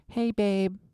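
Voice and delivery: put-on high, lovestruck teen voice; teen boyfriend voice. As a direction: gush like a young teen in love. Young self on phone, high voice.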